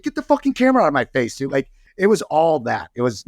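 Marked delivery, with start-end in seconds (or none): 0.0-1.5 high, fast mock snarl at the camera; angry heckler. Fan with camera in his face, mock angry snap. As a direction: snap at the guy filming.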